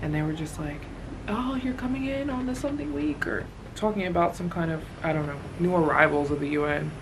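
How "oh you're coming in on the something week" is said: higher, softer quoted voice; lightly put-on high voice; mimicked stranger. Mimicking the airport strangers' question.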